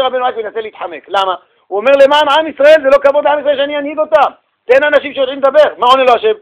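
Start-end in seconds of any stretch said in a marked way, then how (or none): 2.0-5.7 higher, louder pleading; pleading Moses. Retold or imitated. Moses pleading with God to send another, higher and louder. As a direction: beg to be excused.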